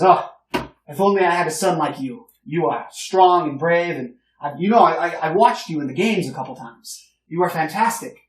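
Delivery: put-on older gentleman greeting the heroes, loud and hearty; boisterous older gentleman. Old gentleman gushes over hero.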